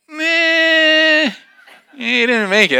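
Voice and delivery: very high, drawn-out loud "meh"; doubting mock bleat. Group reacting to the girl's false summit, mock doubting. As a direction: burst her bubble with a comic high noise.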